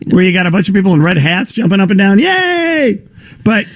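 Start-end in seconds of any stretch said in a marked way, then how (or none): 2.2-3.0 high, mocking crowd cheer. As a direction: squeal it like a rally fan, mockingly.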